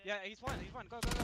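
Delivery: high excited push calls; combat callout. Real, not acted. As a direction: yell the push, go go go. Pushing enemy in fight.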